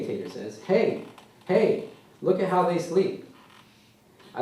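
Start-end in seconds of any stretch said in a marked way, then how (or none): 0.6-3.2 put-on high, louder little-boy voice; eager child demanding attention; excited toddler. A narrator's reading. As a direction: call out like a kid showing off a game. Toddler calling for attention in story.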